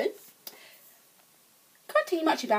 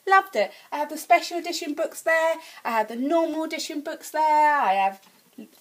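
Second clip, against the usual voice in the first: ordinary delivery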